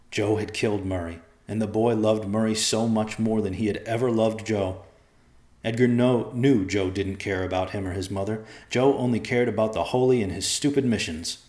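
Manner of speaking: ordinary delivery, nothing marked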